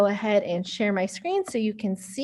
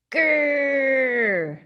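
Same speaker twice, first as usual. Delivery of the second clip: small playful growl; mock growl; 2 semitones higher than usual. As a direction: growl like a tiny tiger. Mouse growls again.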